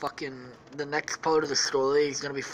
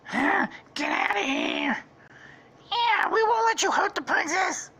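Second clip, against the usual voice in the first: high, squeaky cartoon toads; toad guards. Guards defending the princess, squeaky defiant voice. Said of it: "they say".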